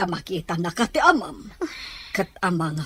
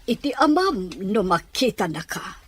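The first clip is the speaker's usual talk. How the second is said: ordinary talk, nothing marked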